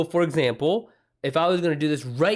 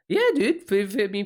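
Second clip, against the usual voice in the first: excited; 7 semitones higher than usual. Real, not acted. Friend urging to share the essay, eager and high. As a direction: cheer a friend on, voice jumping up.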